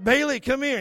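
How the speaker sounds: retold holler to a pet; calling the dog. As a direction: call an old dog over.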